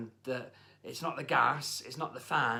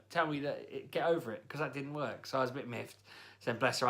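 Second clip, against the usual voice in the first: usual speaking voice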